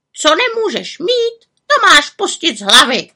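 high, loud squawked advice; raven croak. A narrator's reading. Raven telling the witch to give up, croaking. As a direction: croak it out sternly. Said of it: "krákal havran".

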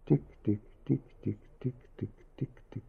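soft, evenly spaced ticks dying away; fading ticking. A narrator's reading. Husband hears clockwork inside his wife, fading ticking.

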